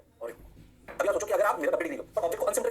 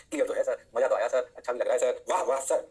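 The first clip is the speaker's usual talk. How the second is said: lower-pitched pupil answering sir; a hint of an obliging student; mock student replies; 5 semitones lower than usual. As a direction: lightly imitate students politely approving. Teacher voices students answering him.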